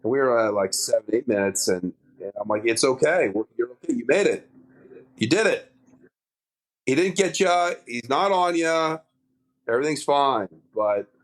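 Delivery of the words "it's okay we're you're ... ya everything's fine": higher, gentle reassurance to a scared friend; reassuring buddy. Retold or imitated. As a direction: soothe him, tell him he's safe. Reassuring panicked dive partner.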